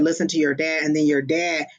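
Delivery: normal talking voice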